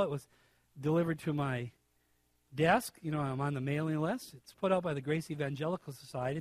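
normal talking voice

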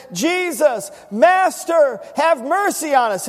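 loud, high plea for mercy; lifted-up voice. Acted. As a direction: call out pleadingly to the master. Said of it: "they lifted up their voices and said".

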